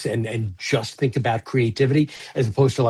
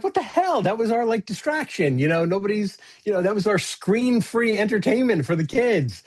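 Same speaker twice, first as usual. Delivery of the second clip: much higher, fussy parent voice; mock angry parent; 7 semitones higher than usual. Parent fuming over the missing peg game, in a high mock-fussy voice. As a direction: play the outraged parent losing the peg game. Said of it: "like, I can see, like".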